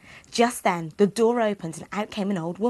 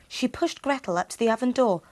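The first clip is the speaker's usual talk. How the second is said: plain reading voice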